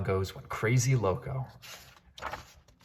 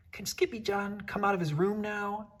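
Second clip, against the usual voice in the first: little sister asking; 12 semitones higher than usual. Acted. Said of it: "asked Jujubee".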